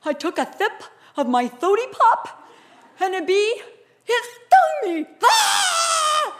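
put-on high, lisping child wailing through a stung tongue; hammed-up bawling kid, rising to a scream; crying son. Son telling the paramedic what happened, lisping tearful wail. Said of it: "and he said".

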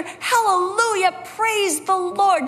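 high, drawn-out hallelujah; rejoicing with a friend over her gift; joyful praise. Retold or imitated. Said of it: "I got to rejoice with her".

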